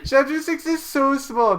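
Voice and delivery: high, loud whining protest; exasperated pleading. Real, not acted. Friend urging CJ to read chapter six, exasperated.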